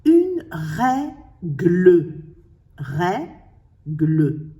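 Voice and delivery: "règle" very slow, as "rè", pause, "gle"; split into syllables. Shown as a demonstration. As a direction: break "règle" in two, giving "gle" its own beat. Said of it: "Le G fait partie de l'autre syllabe".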